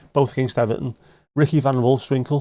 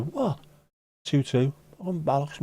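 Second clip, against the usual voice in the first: high, softer startled reaction; retold disbelief; 4 semitones higher, 4 dB quieter than usual. Fan hearing the score went two two, disbelief. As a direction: react in mild mock dismay. Retold shock at late equaliser.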